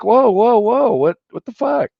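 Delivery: a shocked high cry breaking up a fight; alarmed shout. Retold or imitated. Stepping in as bouncers grab his friend, high alarmed yell. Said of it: "And I'm like".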